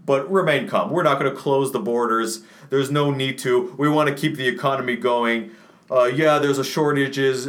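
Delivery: mocking officials who said remain calm. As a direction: parody a complacent official soothing the public. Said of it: "calm has reigned supreme".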